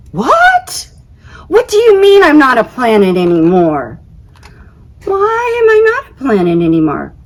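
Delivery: high, loud disbelieving squeal; shocked outrage. Acted. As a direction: shriek it as the news lands. Phone news he's not a planet.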